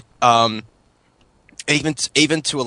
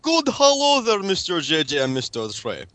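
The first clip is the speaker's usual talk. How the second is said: higher-pitched mock Russian accent with broken English; fake Russian accent; 5 semitones higher than usual. Prank caller phoning hosts in fake Russian accent. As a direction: play a hammed-up Russian caller. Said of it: "was a good Russian accent you had going there".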